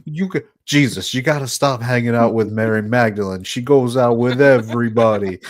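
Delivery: a hammed-up Jersey friend giving advice; Jersey Italian accent. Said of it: "Jersey Italian style".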